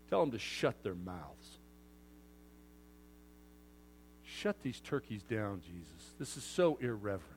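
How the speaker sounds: low, soft, sour disdain. Retold or imitated. Preacher voicing the Pharisees, soft sour disapproval. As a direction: sneer at the rabble quietly. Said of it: "a sour look on their face".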